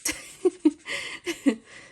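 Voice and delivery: short burst of giggles; laughter. Real, not acted. Chatting to viewers about her sister, laughter. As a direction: laugh it out briefly.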